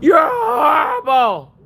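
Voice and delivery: loud, high furious yell; angry shout. Real, not acted. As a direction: tell them off at full volume. Yelling at chat.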